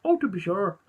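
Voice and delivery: lightly put-on Irish accent; jokey stock Irish lilt. Impressionist warming up for an Irish judge, mock Irish accent. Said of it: "he's Irish".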